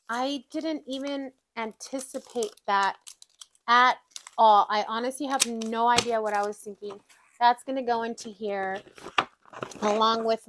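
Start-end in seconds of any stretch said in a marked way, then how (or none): none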